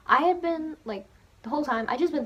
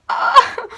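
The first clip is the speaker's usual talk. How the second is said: starstruck shriek; 13 semitones higher, 16 dB louder than usual. Retold or imitated. Staring at Platts in awe.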